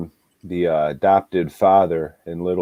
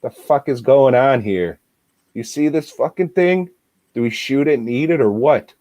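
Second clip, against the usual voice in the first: higher, alarmed and fast; baffled outburst; 6 semitones higher than usual. Retold or imitated. Brother pointing at the lamb thing, alarmed. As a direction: react to a monster. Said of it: "he just comes in like".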